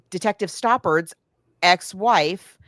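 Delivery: ordinary talk, nothing marked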